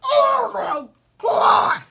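slow, loud, high cry of disgust; disgusted shout. Real, not acted. Reacting to pineapple juice taste.

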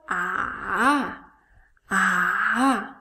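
"Ah" held low, then lifted; turning third tone. Shown as a demonstration. Teacher has learners read after her, dipping "ah". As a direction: dip "ah" low and let it rise out. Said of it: "You dip it first".

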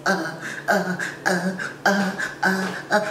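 quiet strained pained "uh" grunts; mock pained grunting. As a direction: grunt through the pain. Retold pain reaction.

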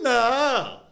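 acted-out coach putting his foot down; emphatic refusal. Coach to his team calling off the deal, emphatic refusal.

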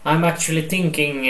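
normal talking voice

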